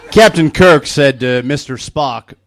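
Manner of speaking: ordinary delivery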